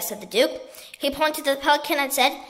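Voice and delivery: plain reading voice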